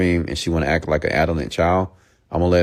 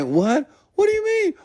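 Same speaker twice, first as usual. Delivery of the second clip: much higher falsetto, mocking a crying woman; mock crying girlfriend. Mocking the dumped girlfriend, high shrill falsetto. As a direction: squeak it like a hurt girlfriend. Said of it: "She's gonna cry".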